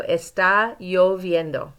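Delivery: "Está lloviendo" slowly, the double L as a Y; slow model, Y sound. Shown as a demonstration. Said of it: "I shouldn't hear any L sounds in there".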